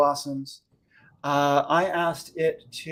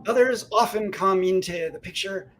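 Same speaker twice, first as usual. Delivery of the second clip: put-on Scottish accent; 6 semitones higher, 3 dB louder than usual. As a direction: read it in a broad Scottish accent. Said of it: "in a Scottish accent".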